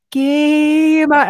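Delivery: high, long, held "GAAAME on"; mimicked wrong version. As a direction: mockingly stretch her "game on" on one high note. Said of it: "You're like".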